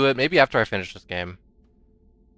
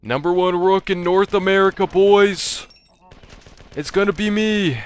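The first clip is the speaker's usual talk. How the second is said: high, loud hype shout; hype announcer; 9 semitones higher, 6 dB louder than usual. Pretend. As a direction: bellow it like a hype man. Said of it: "I keep making the joke".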